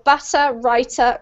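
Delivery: butter and writer with a clear T, no final R; UK T sound. Shown as a demonstration. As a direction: say butter, writer British-style, T fully released. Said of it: "we pronounce the T".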